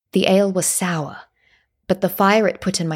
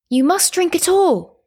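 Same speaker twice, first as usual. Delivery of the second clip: raised, insistent command; firm order; 11 semitones higher, 3 dB louder than usual. A narrator's reading. Matron makes the boy finish the drink, firm insistent order. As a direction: insist with pitch jumping up.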